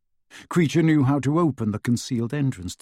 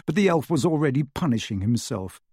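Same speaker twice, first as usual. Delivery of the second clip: plain reading voice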